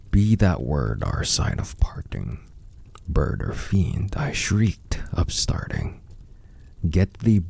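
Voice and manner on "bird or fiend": ordinary delivery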